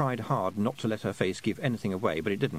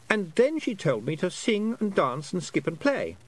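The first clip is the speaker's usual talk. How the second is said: put-on high, lightly boyish voice, puzzled; a lost boy working out how long he's been away; young boy Roland, unsure; 9 semitones higher than usual. A narrator's reading. Lost boy telling Tiffany about time, high and puzzled. Boy retells the Queen's orders.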